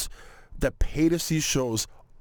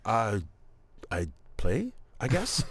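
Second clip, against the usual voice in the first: lower, halting, sheepish reply; sheepish hesitation; 5 semitones lower, 4 dB quieter, slower than usual. Retold or imitated. Comedian cornered by strangers about dominoes, sheepish. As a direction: answer haltingly, pausing after the uh.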